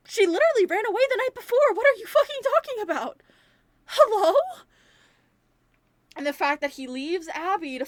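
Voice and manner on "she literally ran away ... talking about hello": high, loud incredulous outburst; outraged disbelief. Real, not acted. Viewer shouting back at Mike's dismissal, outraged. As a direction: yell it at him like he's clueless.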